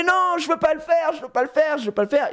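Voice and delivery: high-pitched mock protest, repeated in a rush; panicked kid refusing. Acts out the boy refusing.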